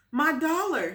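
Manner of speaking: put-on high, loud panicked boy; boy finds his pocket empty, high panicked cry; dismayed cry. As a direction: cry out in alarm. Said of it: "he cried".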